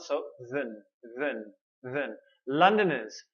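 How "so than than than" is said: than softly with a schwa; weak-form demo. Shown as a demonstration. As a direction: say than as a quick soft thən. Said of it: "a small vowel sound".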